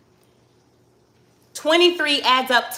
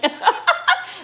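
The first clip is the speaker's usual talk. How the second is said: amused laugh. Real, not acted. Laughs at her own analysis.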